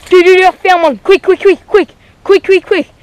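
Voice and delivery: loud, high, rushed calling; urgent excited shout. Real, not acted. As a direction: yell urgently for help. Urgent call to fetch camera.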